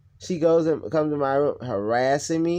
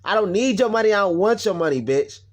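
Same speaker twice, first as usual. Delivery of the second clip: high, loud contempt; angry telling-off; 6 semitones higher, 5 dB louder than usual. Real, not acted. Speaker addressing the mother who wronged them, real anger. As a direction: spit insults at someone absent.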